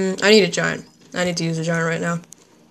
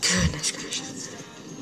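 slow breathy whisper of dismay; 3 semitones lower, 12 dB quieter, much slower than usual. Real, not acted. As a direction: whisper it slowly under your breath. Groans at losing game.

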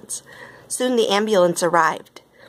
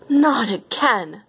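high, drawn-out dismay; storybook rival's exasperation; exasperated thought; 5 semitones higher, much slower than usual. A narrator's reading. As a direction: groan the words slowly, pitched up. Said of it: "she thought".

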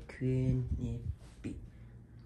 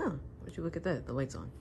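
ordinary delivery

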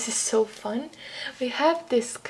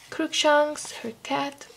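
ordinary talk, nothing marked

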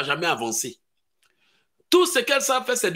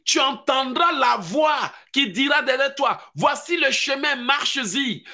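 preaching at full force; 7 semitones higher than usual. Acted. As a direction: thunder the scripture out. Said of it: "La Bible dit".

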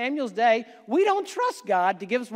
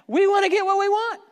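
mock greedy voter; 9 semitones higher, 4 dB louder than usual. Mocking selfish voters' demand.

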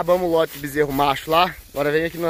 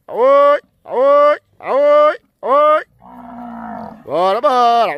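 high, loud yells at the cows; loud calls pushing a stubborn herd; cattle calls; 11 semitones higher, 10 dB louder, much slower than usual. Real, not acted. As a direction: shout the stubborn cows along. Chasing stubborn cows to the corral.

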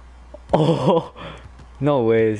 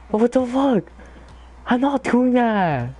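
exasperated; 10 semitones higher than usual. Real, not acted. Frustrated gamer protesting the controls.

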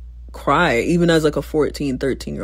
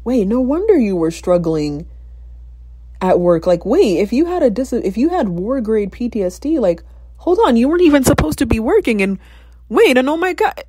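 rising higher and louder with each realization, up to a stunned cry; building disbelief; 7 semitones higher, 3 dB louder than usual. Retold or imitated. To herself, piecing together her mom's struggle, in rising disbelief. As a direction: let the shock climb until it breaks into oh my God. Retold dawning realization about mom.

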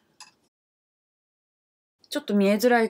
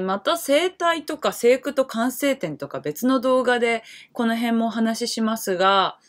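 ordinary talk, nothing marked

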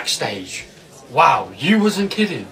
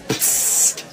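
sneaky psst; 5 dB quieter than usual. Acted. Sneaking into cinema back entrance.

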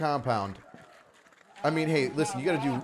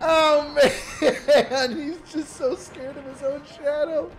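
high, loud, laughing through the words; cracking up; 12 semitones higher, 6 dB louder, much slower than usual. Real, not acted. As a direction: overcome with laughter. Laughing fit.

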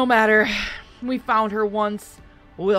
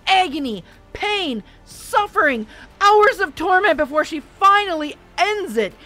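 slow, much higher and louder raging rant; furious tirade; 7 semitones higher, 8 dB louder than usual. A narrator's reading. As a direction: build a terrifying, furious lecture. Markham rages at Melissa.

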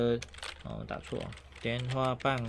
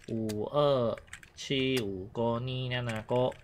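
slow demo; much slower than usual. Shown as a demonstration. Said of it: "中间那个念".

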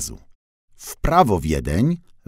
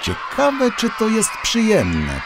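much higher little van voice; curious wondering; 13 semitones higher than usual. A narrator's reading. Van watches riders upside down, much higher and curious. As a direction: wonder aloud, wide-eyed. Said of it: "pomyślał".

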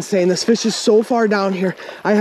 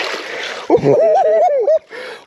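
long, high-pitched celebratory whoop; elated whoop. Real, not acted. Angler who just got the fish, elated whoop. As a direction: let out a high victory holler.

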